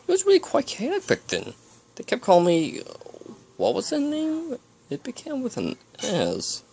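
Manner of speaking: high, swooping, indignant then reminiscing; exaggerated, singsong grandiose character voice; theatrical chaos creature. Voices Discord in the story.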